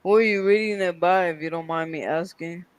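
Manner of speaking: usual speaking voice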